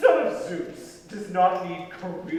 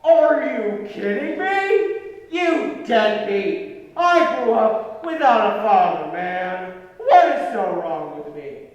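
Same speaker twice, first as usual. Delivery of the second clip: angry yelling; 4 semitones higher, 7 dB louder than usual. Acted. Son yelling at absent father.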